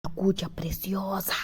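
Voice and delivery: whispering. Acted. Character gloats over needle.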